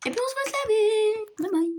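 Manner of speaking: bright, high singsong goodbye. Real, not acted. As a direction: sing it out high.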